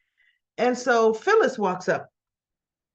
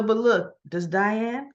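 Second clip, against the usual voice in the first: ordinary delivery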